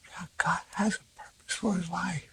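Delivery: hushed breathy whisper of wonder; awed whisper. Retold or imitated. Mother whispering back to the doctor, awed. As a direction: breathe it out in quiet awe.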